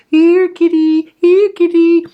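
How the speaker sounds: high, loud, slow calling out; hollering. A narrator's reading. Teacher hollering for the cat under her coat. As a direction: holler for the cat while running blind.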